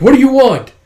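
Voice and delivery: high, loud called-out demand; police shout. Acted. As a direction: shout across to the hijackers. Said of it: "the police are like".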